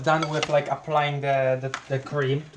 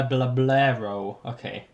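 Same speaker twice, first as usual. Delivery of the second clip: ordinary delivery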